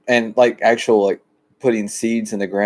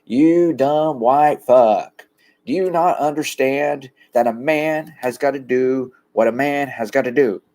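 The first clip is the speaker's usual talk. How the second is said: slow, drawn-out preacher voice imitating the pastor; mock a pastor scolding Nixon; the pastor berating Nixon in a slow preachy drawl; pastor's voice; 4 semitones higher than usual. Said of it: "And the pastor goes".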